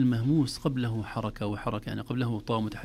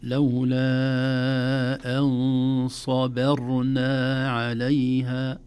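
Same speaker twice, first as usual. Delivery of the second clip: louder, very slow chanted recitation; Quran recitation; 6 dB louder, much slower than usual. Shown as a demonstration. Teacher modelling the full phrase, slow chanted recitation. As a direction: recite with long-held nasal N and vowels.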